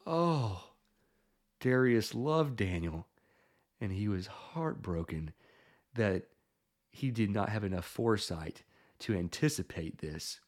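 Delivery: soft, low, halting narration; hushed, downcast storytelling; heartbroken. A narrator's reading. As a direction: let it sink, quiet and sorrowful, with long pauses. King's heartbreak, told softly.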